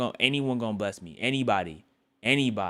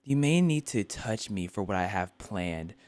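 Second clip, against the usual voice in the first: book reading; 4 semitones lower, 4 dB quieter than usual. Acted. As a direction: read the passage quietly. Continues reading.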